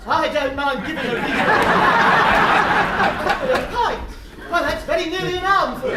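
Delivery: bigger, hammed-up indignant take. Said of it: "I think I'll do it again".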